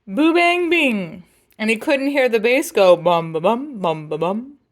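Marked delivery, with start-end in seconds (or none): none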